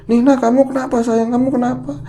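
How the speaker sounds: high, anxious tenderness; worried mother. Retold or imitated. Mother asking her shivering daughter what's wrong, worried. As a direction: ask the child what happened, scared. Mother asks crying child.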